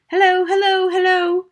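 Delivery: high, loud parrot call; high-pitched projected bird voice. A narrator's reading. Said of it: "the bird called down to her".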